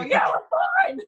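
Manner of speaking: imitated group reply, called out; called-back answer. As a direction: call back across the shop. Answering the yelled check.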